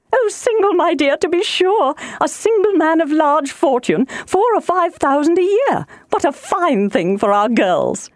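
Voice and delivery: high-pitched, gushing matron; thrilled matchmaking mother, pitched high; excitable wife, delighted. Acted. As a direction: gush over a prize catch. Voices delighted Mrs Bennet.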